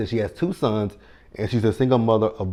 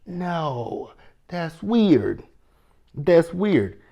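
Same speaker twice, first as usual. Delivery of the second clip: that's weird; 7 semitones higher, much slower than usual. Retold or imitated. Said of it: "I was like".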